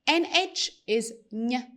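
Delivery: ordinary talk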